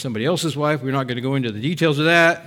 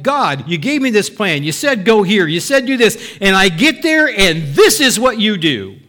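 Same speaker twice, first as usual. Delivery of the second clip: louder, higher indignant protest; complaining to God; 4 semitones higher, 6 dB louder than usual. Retold or imitated. Abram protesting to God after the famine, louder and higher. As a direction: accuse God, voice rising.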